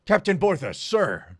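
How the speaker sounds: loud, high barked reply; snapped salute. Acted. Mackin saluting the prince, loud barked reply. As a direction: snap to attention and sound off. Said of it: "Mackin snapped a salute".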